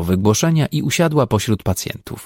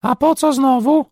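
man squeaking in a mock wife's voice; lisping squeak. A narrator's reading. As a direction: squeal it with a lisp like a housewife. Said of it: "piszczał dalej Janusz".

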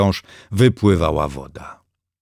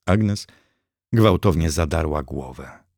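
ordinary delivery

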